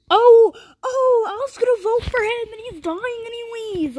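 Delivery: high wail, starting loud then fading; dismayed outburst. Real, not acted. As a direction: cry out in high-pitched dismay. Dismay at another player's fate.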